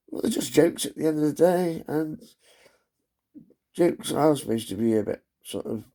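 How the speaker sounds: ordinary talk, nothing marked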